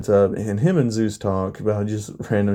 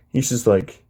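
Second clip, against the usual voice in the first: plain reading voice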